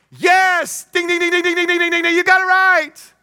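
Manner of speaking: very high, ringing burst of celebration; excited game-show winner call. Acted. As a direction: cheer the right answer like a buzzer going off. Acting out Jesus cheering the right answer.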